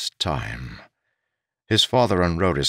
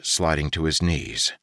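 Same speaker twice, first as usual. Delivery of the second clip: ordinary delivery, nothing marked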